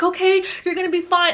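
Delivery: high-pitched frantic reassurance; freaking-out friends. Retold or imitated. Friends driving her to hospital, panicked reassurance. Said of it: "So they're freaking out".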